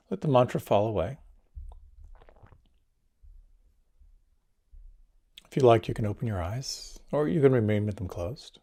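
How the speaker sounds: guided meditation. Acted. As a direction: ease the listeners into calm.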